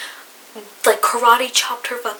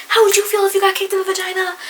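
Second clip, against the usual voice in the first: much higher, fast, shrill-free mock yell of an angry mom; imitating screaming mother; 10 semitones higher, 4 dB louder, faster than usual. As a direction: parody an outraged mother shouting. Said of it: "starts screaming her head off at me".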